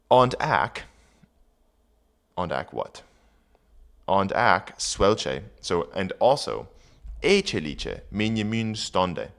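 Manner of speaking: put-on reading Old English aloud; careful Old English recitation. Shown as a demonstration. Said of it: "reading Old English aloud".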